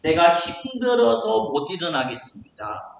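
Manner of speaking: exasperated protest, slowing at the end; acted discouragement. Discouraged believer complaining to God, acted exasperation. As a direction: plead worn out, then give up. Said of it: "실망하고 낙심하고".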